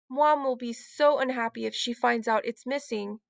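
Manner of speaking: plain reading voice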